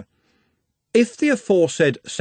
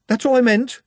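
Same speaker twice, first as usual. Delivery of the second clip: flustered; 13 semitones higher, 4 dB louder than usual. A narrator's reading. Maid backpedals after her gaffe.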